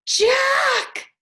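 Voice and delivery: high, loud alarmed shout of his name; a girl spotting a dinosaur, crying out; startled cry. A narrator's reading. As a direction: cry out in sudden fright. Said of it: "she gasped".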